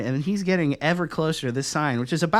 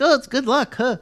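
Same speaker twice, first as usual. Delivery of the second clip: mock cheery voice; 8 semitones higher, 4 dB louder than usual. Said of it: "people are like".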